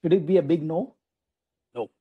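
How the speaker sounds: ordinary talk, nothing marked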